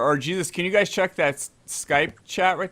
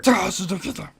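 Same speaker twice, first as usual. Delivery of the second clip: higher, ridiculous put-on warrior voice; mock battle cry, pitched up; Sub-Zero; 5 semitones higher, 4 dB louder, faster than usual. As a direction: echo it as a goofy game fighter. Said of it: "totally ridiculous voice".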